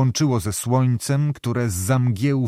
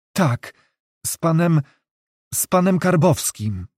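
higher, broken by hesitant pauses; halting, as if chilled; 4 semitones higher than usual. A narrator's reading. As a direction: confess it haltingly, with a slight shiver of unease. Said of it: "jakby ją przejmowało dręczące zimno". (z wahaniem, jakby z zimna)

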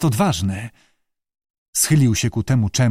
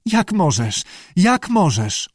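higher tearful reproach, mildly acted; sobbing; 6 semitones higher, slower than usual. A narrator's reading. Shivering Lee reproaches Abe, mildly sobbing. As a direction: repeat it with a touch of sobbing. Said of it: "szlochała i szczękała zębami".

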